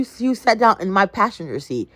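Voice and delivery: normal talking voice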